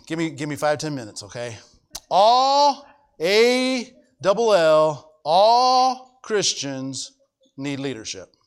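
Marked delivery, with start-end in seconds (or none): 2.1-6.2 "All" drawn out long and loud, spelled slowly; emphatic stress. Real, not acted. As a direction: hammer "All", stretching it right out.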